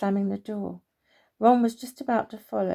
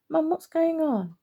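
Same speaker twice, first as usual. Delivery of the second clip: put-on a boy calling out, confused, to his mother; child's voice; 7 semitones higher than usual. Boy calls out to his mum.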